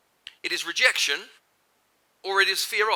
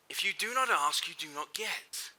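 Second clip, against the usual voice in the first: softer and slower, letting "get" fade; quiet slow; 8 dB quieter than usual. Shown as a demonstration. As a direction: drop the voice and slow down for the reprise. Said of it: "Finish this sentence for me".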